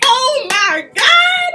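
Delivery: very high, loud, slow drawn-out squeal; fangirl scream. Retold or imitated. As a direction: let it out like a starstruck fan. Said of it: "I was just like".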